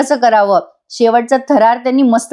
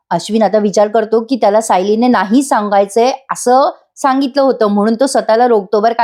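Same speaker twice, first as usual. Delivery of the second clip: ordinary talk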